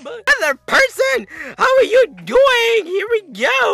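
very high, loud, wobbling shouts; hammed-up YouTuber squeal; screeching auto-tune-like hype. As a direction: scream the kill call with stretched swoops. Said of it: "like a really bad case of auto-tune".

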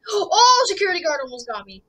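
gasp, then rushed relief; gasping in fright. Real, not acted. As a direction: gasp loudly then blurt it out fast. Near catch by the guard.

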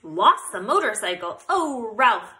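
loud alarmed cry; genuinely alarmed. A narrator's reading. Mother hearing the motorcycle is lost, alarmed cry. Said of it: "cried his mother, genuinely alarmed".

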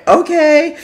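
high-pitched, uneasy okay; taken aback. Retold or imitated. Passenger after driver touches her lap, high startled okay. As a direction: react with a mock-startled jump in pitch.

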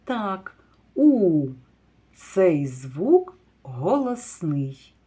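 ordinary talk, nothing marked

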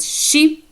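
"ші" opening on a clear sh; soft sh sound. Shown as a demonstration. Teacher showing how the letters read, clear sh in "ші". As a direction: say "ші" with sh, not sk. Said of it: "читаєте як ші".

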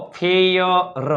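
slow, split-up ફે ય ર; syllable-by-syllable demo. Shown as a demonstration. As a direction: slowly sound out ફે ય ર. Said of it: "એફ એટલે ફ".

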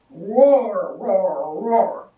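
loud growled roars; bear roar. A narrator's reading. Bear stomping down the mountain, loud roaring.

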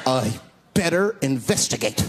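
lightly voiced horror-film hero. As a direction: resolve it with mock-grave deliberation.

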